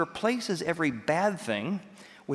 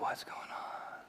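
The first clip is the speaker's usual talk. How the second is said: hushed unvoiced whisper of puzzled students; 13 dB quieter, slower than usual. Students whispering to each other in puzzlement. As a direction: whisper it as if afraid to be heard.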